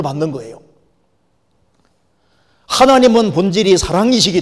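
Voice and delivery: loud, emphatic sermon delivery; full-force preaching. Real, not acted. Preacher urging people to grasp God's love, full-force preaching. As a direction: drive each phrase home from the pulpit.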